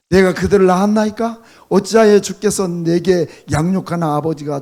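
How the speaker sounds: loud, rising preacher's reading of Moses' lament; preaching voice, pushing the complaint upward; fervent scripture reading. Acted. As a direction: proclaim the lament with full force. Reads Moses' lament aloud.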